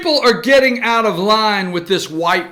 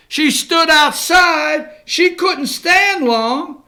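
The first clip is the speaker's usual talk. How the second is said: sarcastic mockery; 5 semitones higher, slower than usual. Real, not acted. Host mocks her excuse, sarcastic slow jab. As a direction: drag it out in mock disbelief.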